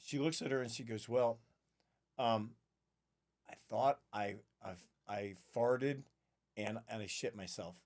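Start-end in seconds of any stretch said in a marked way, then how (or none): 1.1-7.8 halting, low and soft acted admission; sheepish confession. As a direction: confess reluctantly, pausing and dropping away softly. Flight attendant's awkward confession, acted.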